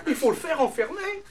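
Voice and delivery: high, laughing neighbour voice; mocking neighbours. Neighbours demand the lion be locked up, high laughing. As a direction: sneer it out, half laughing. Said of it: "les voisins disent".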